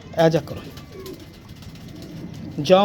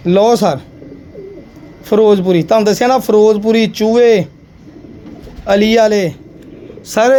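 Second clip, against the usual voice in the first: calling out; 7 dB louder than usual. Real, not acted. Seller calling out pigeon breeds.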